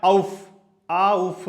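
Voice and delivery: आउफ, आउफ said slowly with a German au; teacher demonstrating German आउफ slowly; slow German pronunciation. Shown as a demonstration. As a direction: draw out आउफ twice. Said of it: "तो क्या बन गया?".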